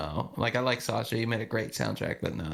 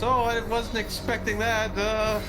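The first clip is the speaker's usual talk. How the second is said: startled, pitched up; surprised, higher; 9 semitones higher, slower than usual. Retold or imitated. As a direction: caught off guard, much higher. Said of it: "and he goes".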